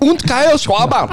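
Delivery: put-on high German-accented announcer call. Friend copying the German announcer joke, in a German accent. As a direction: bark the name in a German accent. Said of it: "the German voice".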